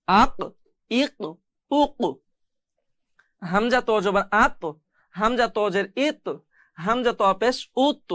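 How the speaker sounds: final q and t in "আক", "আত" struck and released with an echo; qalqalah bounce. Shown as a demonstration. Teacher demonstrating the rule, bounced endings on "উক" and "উত". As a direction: bounce the last consonant of "ইক" and "ইত". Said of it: "এই যে একটা বাড়ি খাচ্ছে পড়ে".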